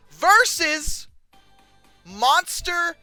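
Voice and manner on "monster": normal talking voice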